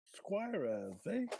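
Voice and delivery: Cockney washerwoman puzzled; cockney question. Acted. Mrs Watson questions Mrs Jones, in a Cockney accent. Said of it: "can you please read for us in your best cockney accent".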